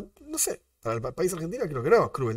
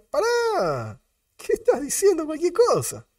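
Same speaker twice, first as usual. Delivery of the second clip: exasperated outcry; 13 semitones higher, slower than usual. Acted. Mock reaction to absurd food ban.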